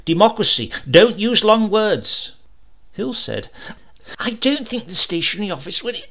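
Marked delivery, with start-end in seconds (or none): 0.0-2.3 much higher, louder, slow pitch; King pushes the committee, loud and slow; eager ad man. A narrator's reading. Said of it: "King said". 4.2-6.0 higher, thin reasoning voice. A narrator's reading. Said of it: "Hill's thin voice was always the voice of reason".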